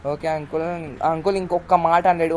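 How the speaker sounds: ordinary talk, nothing marked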